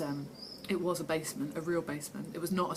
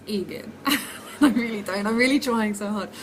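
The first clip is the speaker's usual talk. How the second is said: laughing; 4 semitones higher, 7 dB louder than usual. Real, not acted. Admits she cannot speak Hungarian.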